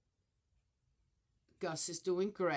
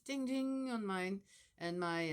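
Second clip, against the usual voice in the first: ordinary delivery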